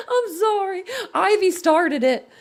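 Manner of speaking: high, teary laugh-cry, then a slow apology; laughed so hard the eyes are watering; crying with laughter. Real, not acted. Tears from laughing at clip.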